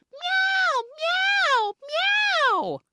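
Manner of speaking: very high, loud cat mews; squealing imitation of excited cats; shouted meowing. Crowd of cats reacts to the kiss, high shouted meows. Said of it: "everyone is shouting, A K meowing".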